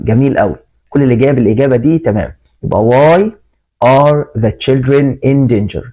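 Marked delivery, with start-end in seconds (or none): 2.9-5.8 slow read-aloud. Shown as a demonstration. Teacher reads ordered sentence.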